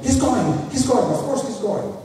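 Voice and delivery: high, loud mock family clamor; excited insistence. Relatives calling to insist he travels, excitedly. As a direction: exclaim it high, easing in volume on the last phrase.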